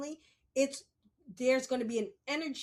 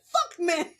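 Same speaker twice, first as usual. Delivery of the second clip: salty outburst; 6 semitones higher, 9 dB louder than usual. Pretend. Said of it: "I'd be like".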